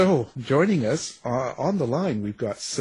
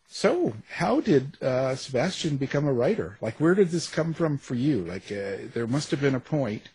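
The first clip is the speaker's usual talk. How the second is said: ordinary talk, nothing marked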